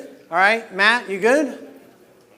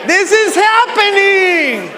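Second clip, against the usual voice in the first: fired-up shouting; 7 semitones higher, slower than usual. Real, not acted. Coach pumping up the crowd over their shouting. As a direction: hype the room to full volume.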